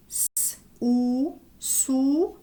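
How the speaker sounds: long hissed S, then "ou", joined as "sou"; teacher sounding out "sou" sound by sound; syllable blending demo. Shown as a demonstration. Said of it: "ça se lit comme ça".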